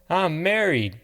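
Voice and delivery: sudden high yelp. Acted. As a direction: blurt it out in a startled yelp. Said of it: "Rita yelped".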